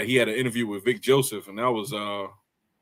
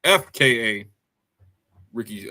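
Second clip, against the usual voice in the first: ordinary delivery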